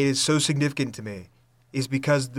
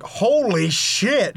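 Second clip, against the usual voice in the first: mock shock; 7 semitones higher, 4 dB louder, much slower than usual. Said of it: "And he's like".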